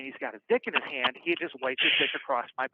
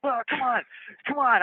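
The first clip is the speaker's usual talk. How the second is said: higher, pleading retold challenge; mock tearful; 9 semitones higher, 4 dB louder than usual. Cornered inmate facing his attacker, mock tearful bravado. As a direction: imitate squaring up while crying. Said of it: "I'm like, I'm crying".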